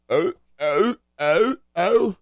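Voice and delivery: high, repeated "oh" yelps; mock dance grunts. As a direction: yelp out a mock dance chant. Imitating a [name] dance vocalization.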